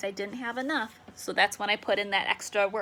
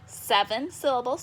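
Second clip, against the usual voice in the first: normal talking voice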